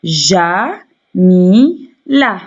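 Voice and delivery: "Ja, mi, la" as three separate beats; syllable by syllable. Shown as a demonstration. Teacher splitting a name for pupils, "Ja, mi, la" syllable by syllable. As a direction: break "Ja, mi, la" apart with clear pauses. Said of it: "Le mot Jamila contient trois syllabes".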